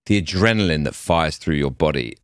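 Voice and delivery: normal talking voice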